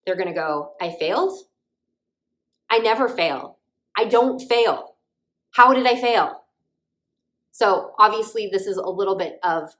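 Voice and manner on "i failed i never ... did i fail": rising, louder disbelief building to an outburst; I never fail, getting more upset; student shocked by a failed test, frustrated and confused; frustrated confusion. Shown as a demonstration. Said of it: "you almost hear that tone of voice".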